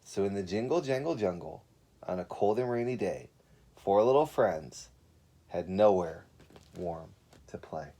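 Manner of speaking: gentle storybook reading; story narration. A narrator's reading. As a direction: read it like a children's picture book. Reads a picture book aloud.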